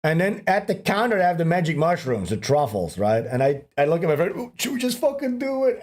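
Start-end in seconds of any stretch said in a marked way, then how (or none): 4.3-5.8 eager egging-on. Retold or imitated. Drunk man to his friend at the counter, eager egging-on. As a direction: tempt a friend into mischief, voice lifted.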